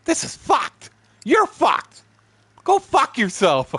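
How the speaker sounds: high, shouted courtroom tirade; furious mock outburst. Friend telling the judge off, angry mock shout. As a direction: yell it at the judge, hammed up.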